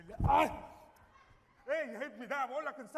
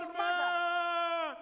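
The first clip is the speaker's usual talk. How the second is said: stretched-out superhero call, louder and higher; slow loud announcement; 6 semitones higher, 7 dB louder, much slower than usual. Acted. Hailing Superman as he arrives, a slow dramatic call. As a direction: call out the hero's name like a big reveal.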